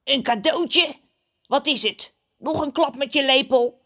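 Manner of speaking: high, squawky, distrustful crow voice; suspicious crow. A narrator's reading. Crow doubts John's present, high and suspicious. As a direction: question it warily in a crow's squawk. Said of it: "knijpt zijn ogen argwanend samen".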